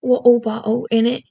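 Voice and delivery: hammed-up British "Water bottle", T's dropped, "in it" as innit; cartoonish British glottal stops on "Water bottle". Shown as a demonstration. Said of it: "some very stereotypical ones too".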